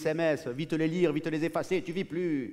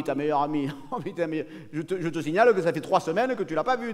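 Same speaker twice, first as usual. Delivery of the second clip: normal talking voice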